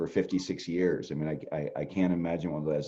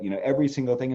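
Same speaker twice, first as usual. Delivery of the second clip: ordinary talk, nothing marked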